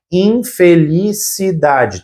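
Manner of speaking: "infelicidade" drawn out in separate chunks; measured dictation of "infelicidade", piece by piece; slow, syllable by syllable. Shown as a demonstration. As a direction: break "infelicidade" slowly into in-feli-ci-dade. Teacher breaking down the derived word.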